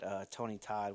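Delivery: usual speaking voice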